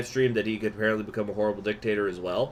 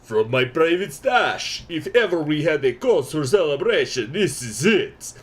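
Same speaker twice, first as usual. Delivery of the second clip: put-on Russian accent; 6 semitones higher, 7 dB louder than usual. Markev offering his vodka to comrades, loud Russian toast. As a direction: boast like a soldier opening his vodka. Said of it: "my Russian accent".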